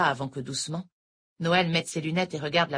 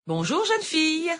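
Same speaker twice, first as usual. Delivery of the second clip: much higher, slow sing-song hello; eerie teacher's drawn-out greeting; odd sing-song greeting; 13 semitones higher, 4 dB louder, slower than usual. A narrator's reading. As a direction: stretch it out, strangely sweet. Noël greets oddly.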